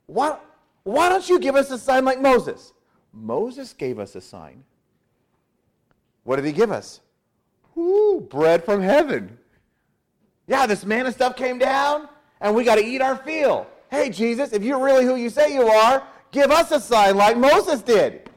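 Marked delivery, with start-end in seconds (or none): 0.1-2.7 high-pitched, pressing question; challenging demand. Acted. The crowd demanding a sign from Jesus, high challenging call. 7.7-18.3 high, whooping enthusiasm turning into a demand; excited crowd demand. Acted. Crowd asking Jesus for manna like Moses, high excited demand.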